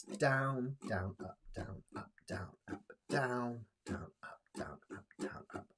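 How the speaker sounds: softly whispered down up pattern; whispered strum count. Shown as a demonstration. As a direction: whisper the strum directions in time.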